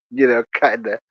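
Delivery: normal talking voice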